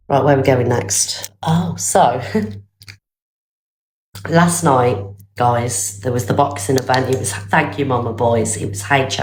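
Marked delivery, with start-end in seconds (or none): none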